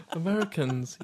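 ordinary talk, nothing marked

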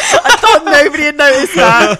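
laughing. Real, not acted. Laughing at a joke.

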